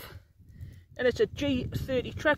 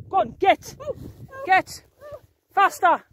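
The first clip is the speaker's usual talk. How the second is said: loud, higher calls driving her on; shouting orders; 8 semitones higher, 5 dB louder, slower than usual. Real, not acted. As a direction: yell at her to hurry up. Shouting the llama up the hill.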